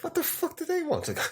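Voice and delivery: squeaky high disbelief, laughing; incredulous laugh. Retold or imitated. Incredulous retold reaction, laughing.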